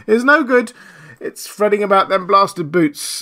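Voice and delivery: loud, high-pitched lament; a tramp bemoaning his luck, voice pushed up; despairing tramp. A narrator's reading. Marvel blaming his blasted boots, despairing.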